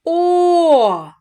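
"О" held long, high and loud; isolated vowel. Shown as a demonstration. Teacher shows the vowel, long loud О. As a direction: call out a long round "О". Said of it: "Голосний".

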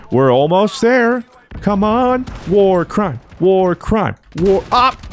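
high, eager hype; giddy countdown to the big moment; building anticipation. Real, not acted. Building hype for a long-awaited kill streak.

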